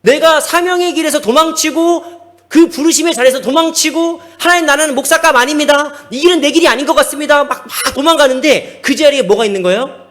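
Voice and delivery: loud, high-pitched sermon climax; impassioned preaching. Real, not acted. Preacher recalls fleeing his calling from God, impassioned preaching. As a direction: preach it at full force.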